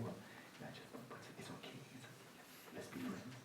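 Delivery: hushed, coaxing murmur to the mind; placating half-whisper, imitated; mock soothing whisper. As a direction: whisper it like making peace.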